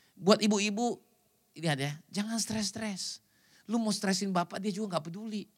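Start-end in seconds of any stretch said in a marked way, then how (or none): none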